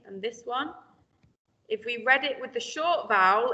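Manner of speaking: normal talking voice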